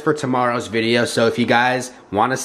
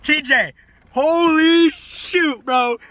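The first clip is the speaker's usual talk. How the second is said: shocked; 11 semitones higher than usual. Real, not acted. Shock after a scare.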